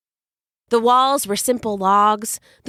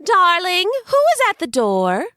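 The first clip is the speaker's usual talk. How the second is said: much higher, louder call from another room; 10 semitones higher, 4 dB louder than usual. Acted. Wife calling from inside.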